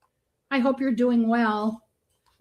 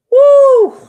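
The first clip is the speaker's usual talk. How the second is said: loud, high woo; big high-pitched woo; cheering whoop; 16 dB louder than usual. Real, not acted. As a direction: whoop in excitement. Cheering shout.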